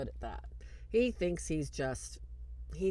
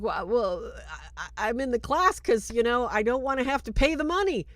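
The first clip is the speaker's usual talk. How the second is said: stammering, louder mock defensiveness; retold sheepish protest, voice climbing; flustered excuse; 4 semitones higher, 6 dB louder than usual. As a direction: stumble into a higher, hammed-up excuse. Michael makes excuses when questioned.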